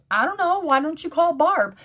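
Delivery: a hint of mom suggesting calmly; voicing mom. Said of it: "She goes".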